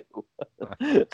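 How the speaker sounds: a short burst of real laughter, rising. Real, not acted. Telling a podcast host a funny Ken Norton story, bursting out laughing. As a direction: laugh out at the punchline. Laughs at his own punchline.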